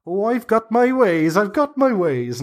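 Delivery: put-on high, sly old hag voice; teasing high crone; coy hag. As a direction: keep her secret, playfully.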